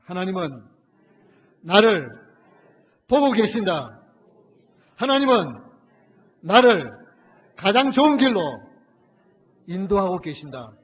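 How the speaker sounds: loud, high preached declaration for the church to repeat; call-and-response shout. Shown as a demonstration. Preacher leads the church in a loud call to repeat. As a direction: proclaim each phrase for them to echo. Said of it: "따라합시다".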